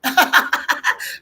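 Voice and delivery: high airy laugh. Real, not acted. Laughing at viewer comment.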